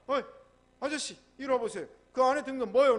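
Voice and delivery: guard's challenge. Acted. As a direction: call out and question a suspect at the gate. Gate guard stops the father. (크고 높게 불러 세우는 위병)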